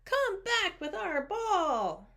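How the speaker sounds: high, slow, drawn-out call; stretched, high-pitched plea; kids calling out. A narrator's reading. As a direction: call after the thief like a child. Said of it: "yelled the kids".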